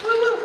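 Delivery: high "woo, woo" cheer; cheering whoop. Real, not acted. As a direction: whoop along with the applause. Cheering and clapping for Dave.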